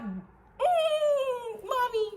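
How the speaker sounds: high, loud put-on wail; exaggerated squealing cry for mommy; mock tearful crying. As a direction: whimper it out like a hammed-up sob. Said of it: "that's when you might get a freaking tear".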